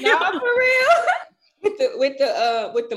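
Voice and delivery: laughing hard, near tears. Real, not acted. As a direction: crack up while saying it. Laughing through emotion.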